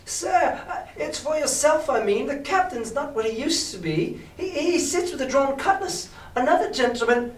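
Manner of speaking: high-pitched lad in pain; frightened boy pleading. A narrator's reading. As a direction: stammer a high plea to the tormentor. Boy pleads, arm wrenched by beggar.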